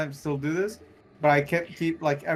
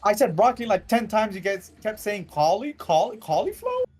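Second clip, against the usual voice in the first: higher, louder protest; exasperated; 6 semitones higher, 4 dB louder than usual. Real, not acted. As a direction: insist hard you said it. Teammates mishear his word.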